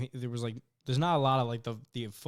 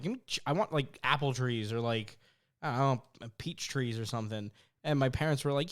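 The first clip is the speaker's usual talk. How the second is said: ordinary talk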